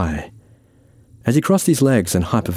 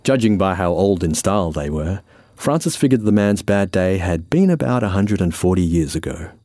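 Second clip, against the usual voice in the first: ordinary delivery, nothing marked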